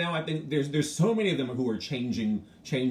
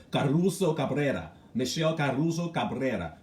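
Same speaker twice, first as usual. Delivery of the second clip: Spanish pronunciation; 2 semitones higher than usual. Shown as a demonstration. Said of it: "rolling R's".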